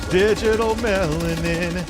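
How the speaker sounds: slow, savoring delivery; drawn-out mock relish. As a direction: stretch the phrase out with relish. Savoring the phrase.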